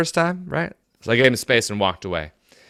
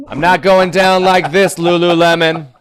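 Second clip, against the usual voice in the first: louder, higher hammed-up refusal; playful outraged shout at the yoga pants; mock defiant yell; 7 semitones higher, 6 dB louder than usual. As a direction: bellow it like a mock battle cry.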